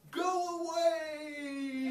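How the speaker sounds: slow, high, stretched yell of the Romans; drawn-out cry. Acted. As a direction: draw "Go away!" out into a long cry. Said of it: "the Romans went".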